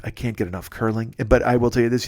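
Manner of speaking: usual speaking voice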